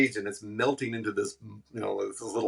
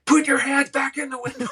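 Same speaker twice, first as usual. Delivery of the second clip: much higher, louder barked command; mock cop yell; 7 dB louder than usual. Prank cop orders Tony around, hammed-up much higher yell. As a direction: bark it like a crazed cop.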